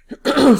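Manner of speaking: rough throat clear. Real, not acted. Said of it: "I'm losing my voice today".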